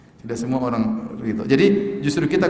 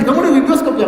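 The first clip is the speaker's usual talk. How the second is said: much higher and louder, laughing through it; laughing mock question; 12 semitones higher, 9 dB louder, much faster than usual. Preacher mocking a nosy friend's question, laughing.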